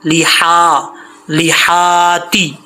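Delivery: लिहाती slowly, हा thin and breathed from the throat, long vowels. Shown as a demonstration. Teacher corrects the हा mistake, slow careful recitation. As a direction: draw out लिहाती with a fine throaty हा. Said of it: "यहाँ पे हा बारीक है".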